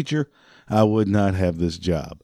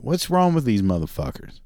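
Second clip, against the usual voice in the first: ordinary talk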